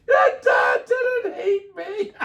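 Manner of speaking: high, loud, slow hammed-up sobbing shout; mock ugly cry. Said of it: "had the ugly cry".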